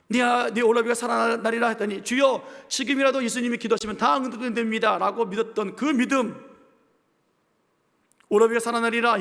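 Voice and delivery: loud, high, driving sermon voice; fiery preaching. Acted. Pastor rebuking the faithless, loud fiery preaching. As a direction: pastor pressing the congregation, loud and rising.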